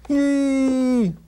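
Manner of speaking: loud high "yee" whoop. Acted. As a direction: cap it with a whoop. Rhyme finale whoop.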